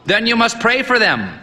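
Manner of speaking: high, booming, lecturing; stern old priest. A narrator's reading. Old priest lecturing the boy on prayer, booming. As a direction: preach at the boy.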